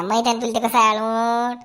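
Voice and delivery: usual speaking voice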